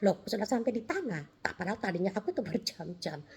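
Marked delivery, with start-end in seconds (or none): none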